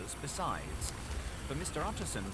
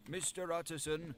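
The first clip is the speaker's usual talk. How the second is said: butler; 8 semitones higher than usual. A narrator's reading. Frightened butler speaks.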